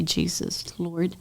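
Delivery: soft, hushed call to Jesus; quiet prayer. Retold or imitated. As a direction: breathe the words like a prayer. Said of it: "I'll say".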